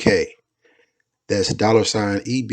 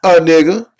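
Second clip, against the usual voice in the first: higher, louder punchline answer; 7 semitones higher, 7 dB louder than usual. Retold or imitated. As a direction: blurt the punchline out loud. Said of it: "Billy would respond loudly".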